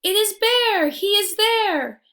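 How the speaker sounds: high, loud excited squawk; squawking birds. Acted. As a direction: birds spotting Bear from the sky. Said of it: "all squawk from the sky".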